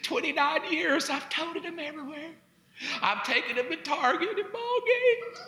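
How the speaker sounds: high, laughing whine of a lost mother; mock wailing wife. Husband mocking his wife's despair over the kids leaving. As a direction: ham up the grieving empty-nester. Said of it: "She's like".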